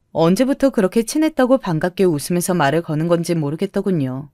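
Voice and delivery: ordinary delivery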